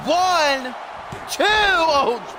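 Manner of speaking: high-pitched wrestling call building to a peak; excited play-by-play. Real, not acted. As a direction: build from tense warning to big, high calls.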